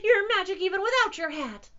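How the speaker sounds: high, loud delight; cheering cry. A narrator's reading. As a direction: squeal it proudly. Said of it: "cried the cats".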